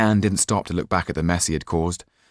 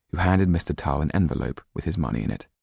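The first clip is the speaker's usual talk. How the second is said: usual speaking voice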